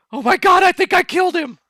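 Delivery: high, loud panicked yell; panicked cry. Acted. Man crying out after killing someone by accident, panicked yell. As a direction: shout it in horror at what you did.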